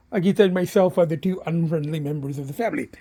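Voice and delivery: usual speaking voice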